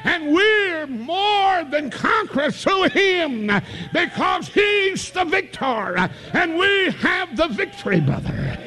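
high, shouted, drawn-out preacher's cry; preaching at full force. Acted. Preacher exalting Jesus to the congregation, full-force preaching. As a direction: lift it up and ring it out to the church.

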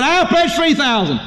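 high, drawn-out declaration; proclaimed pledge. Retold or imitated. Acted rival pledge, topping the last.

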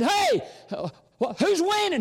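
hammed-up shout to a distant fielder; calling out. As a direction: holler over the fence to the kid. Man calls to boy across field.

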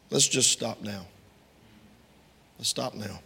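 calming. Shown as a demonstration. Calming a quarrel by example.

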